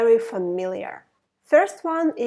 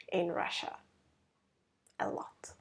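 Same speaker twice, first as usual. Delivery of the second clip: whisper; 5 semitones lower, 13 dB quieter, faster than usual. Acted. Joking aside about caviar.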